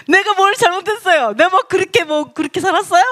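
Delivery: high, aggrieved mock questioning; indignant retort. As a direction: protest as if unfairly blamed. (억울한 듯 높게 되묻는 흉내)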